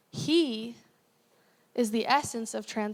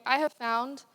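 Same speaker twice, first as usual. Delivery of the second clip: usual speaking voice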